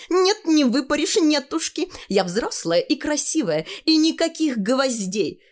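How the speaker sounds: put-on high, cocky childish voice; high-voiced, self-assured refusal; defiant girl. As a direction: talk back in a high girl's pitch. Tanya defies her mother.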